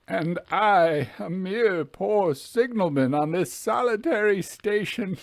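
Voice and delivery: a tormented lonely man begging to know why he was warned; troubled signalman crying out for an answer; anguished signalman. Acted. Signalman voiced in read story.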